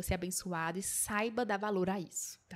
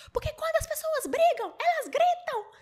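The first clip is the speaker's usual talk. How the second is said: very high, louder, laughing through it; mock yell; 5 dB louder than usual. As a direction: shout it back, cracking up. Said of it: "Eu fui responder isso pra ela". (grito exagerado, rindo)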